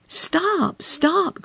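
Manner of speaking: high, pleading frog voice; frog crying out. A narrator's reading. Frog begging the princess to stop, high cry. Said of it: "cried the frog".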